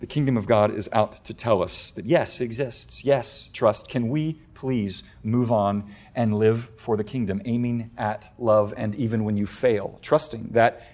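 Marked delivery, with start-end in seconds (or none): none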